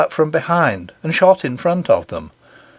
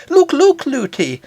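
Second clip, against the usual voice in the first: put-on high, eager child calling look; an excited little girl pointing things out; 12 semitones higher than usual. A narrator's reading. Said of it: "She was always saying".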